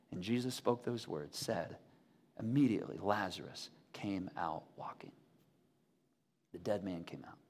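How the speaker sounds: low, soft, near-whispered narration; hushed storytelling. Acted. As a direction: tell the miracle in a hush, letting pauses hang. Hushed telling of the miracle.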